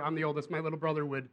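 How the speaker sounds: normal talking voice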